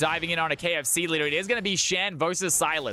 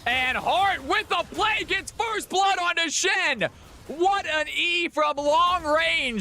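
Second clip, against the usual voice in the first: excited play-by-play; 10 semitones higher, slower than usual. Real, not acted. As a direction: shout the first blood like a caster. Hype casting first blood.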